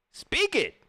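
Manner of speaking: high-pitched, emphatic command; mama's exhortation. Retold or imitated. Streamer quotes what mama tells him, high exhortation. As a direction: urge it like a fired-up mother. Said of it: "that's what my mama tell me".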